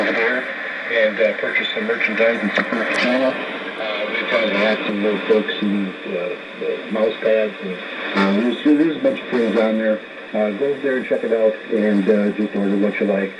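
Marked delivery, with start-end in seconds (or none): none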